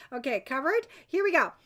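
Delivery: ordinary talk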